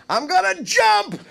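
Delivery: high, slow, far-off shouted plea; Larry David shout. Retold or imitated. Kramer's friend yelling down from a window, high desperate shout. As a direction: yell it out a window like a would-be jumper. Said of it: "Larry David going".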